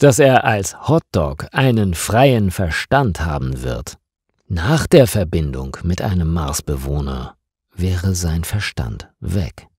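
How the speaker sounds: usual speaking voice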